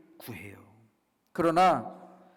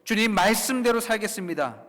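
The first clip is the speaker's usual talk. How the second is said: loud, raised vow to God; bold confession; 5 semitones higher, 6 dB louder than usual. Acted. Believer vowing obedience to the Lord, bold confession. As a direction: declare it with full conviction. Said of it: "또 이렇게도 고백하죠".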